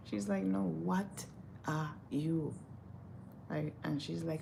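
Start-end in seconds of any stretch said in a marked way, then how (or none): 0.4-2.5 imitated insistent question. Lady pressing the model for her race, imitated insistence. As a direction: repeat it word by word, dropping softer.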